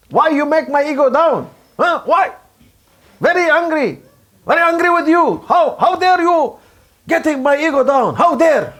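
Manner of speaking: high-pitched indignant outburst; angry. Acted. Preacher acts out his bruised ego, angry. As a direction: demand it in wounded, offended pride. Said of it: "I become angry".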